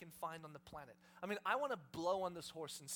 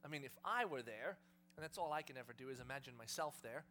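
normal talking voice

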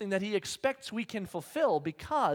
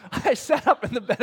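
said through a laugh, louder; tickled by the memory, laughing out the words; 4 semitones higher, 7 dB louder than usual. Real, not acted. Preacher telling the church about the night, laughing.

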